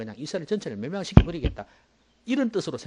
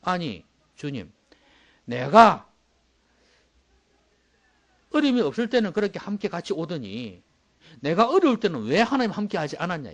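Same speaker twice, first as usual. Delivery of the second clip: mock aggrieved complaint, louder and higher; complaining; 4 semitones higher, 8 dB louder than usual. Man on the sand reproaching the Lord, aggrieved. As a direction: pour out a grievance to the Lord. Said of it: "불평을 털어놨어요".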